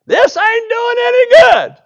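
high, loud, slow frustrated shout in a put-on voice; exasperated yell. Fed-up Naaman shouting at the river in a put-on voice. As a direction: bellow it like a fed-up man getting nowhere.

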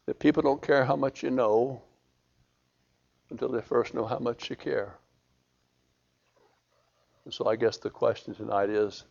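quiet, gentle invitation to prayer; soft altar call. Acted. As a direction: draw them in softly, unhurried.

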